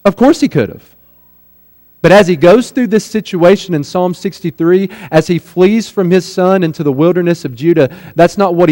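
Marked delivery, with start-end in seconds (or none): none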